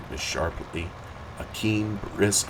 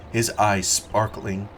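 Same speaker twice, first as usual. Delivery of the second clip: ordinary delivery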